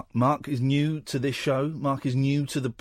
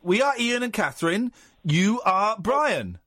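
mock-patient language teacher; talking to a foreigner; 6 semitones higher than usual. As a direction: spell it out word by word as if he barely understands. Mock lesson for non-English speaker.

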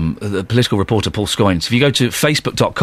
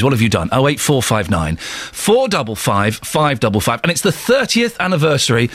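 normal talking voice

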